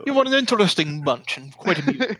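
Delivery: polite dismissal in character; amused mage. Acted. Mage excusing himself to work, lightly amused. Game master voices mage.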